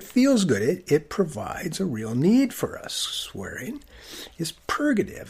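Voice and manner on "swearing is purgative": breathy confidential aside; a hushed secret about swearing; whispered. Acted. Whispered emphasis.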